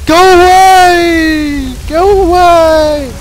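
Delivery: long, stretched-out calls; drawn-out plea. Real, not acted. Gamer calling at the attacking dog, drawn-out plea. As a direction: drag out each word as you shoo it off. Yelling at pursuing game dog.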